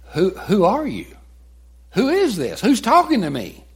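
high, panicked questions; frightened cry. Retold or imitated. Struck-down Saul asks the voice in high panic. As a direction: cry out in fear and confusion.

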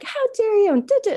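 high, loud, hammed-up indignation; mock finger-pointing scolding. Mom berating cowering son, mock outrage. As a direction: imitate a parent wagging a finger mid-lecture. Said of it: "the finger pointing".

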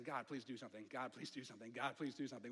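hushed, low pleading under the breath; desperate inner prayer. Retold or imitated. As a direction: beg God quietly, almost to yourself. Moses praying inwardly, terrified.

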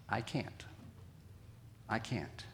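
soft, low, resigned admission; defeated motto. Acted. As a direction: say it quietly, as if giving up. Voicing the weak person's motto.

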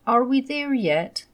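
put-on high, eager child voice; little boy voice. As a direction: ask it like an impatient little boy. Child asking again, car trip.

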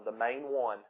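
usual speaking voice

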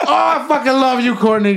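high, fast, mock-agonized declaration; pained outburst. Friend re-enacts forgiving his biter, pained outburst. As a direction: force affection out through re-enacted pain. Retelling reaction through pain.

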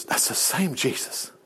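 low, unvoiced whisper of a correction; whispered retort. Real, not acted. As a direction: hush it like a secret truth. Answering doubter's objection.

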